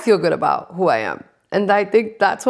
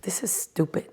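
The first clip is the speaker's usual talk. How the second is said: whispered grumble; 3 semitones lower, 10 dB quieter than usual. Retold or imitated. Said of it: "I was like".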